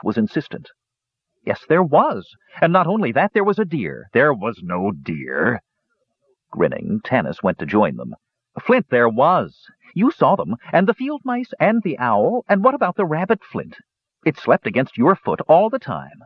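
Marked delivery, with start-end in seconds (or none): 1.4-4.1 put-on lightly raised, eager young kender insisting. A narrator's reading. Kender Tass contradicts the dwarf, eager and insistent. 4.1-5.6 put-on slow, stubborn old dwarf; gruff dwarf. A narrator's reading. Flint flatly denies the deer to Tass, slow and stubborn. 8.6-16.2 high, eager kender piling up proof; excited kender. A narrator's reading. Tass lists the animals to Flint, high and eager.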